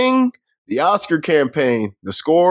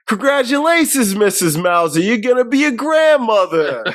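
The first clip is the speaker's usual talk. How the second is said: much higher, bright mock-cheerful announcing; mock announcement; 7 semitones higher than usual. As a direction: chirp the news up high. Quoting doctor announcing grandchild.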